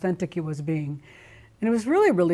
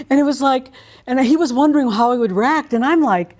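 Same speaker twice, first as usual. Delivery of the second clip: excited; 5 semitones higher, 7 dB louder than usual. Real, not acted. Excited retelling, laughing.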